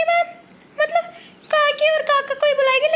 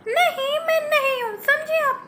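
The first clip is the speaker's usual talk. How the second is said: ordinary talk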